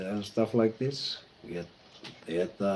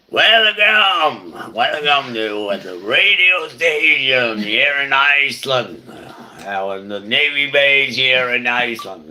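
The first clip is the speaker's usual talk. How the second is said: big, bright announcer voice, pitched much higher and louder; radio DJ voice; 5 semitones higher, 9 dB louder than usual. Retold or imitated. Radio host welcoming listeners from the Navy base, booming announcer voice. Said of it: "He talked like this".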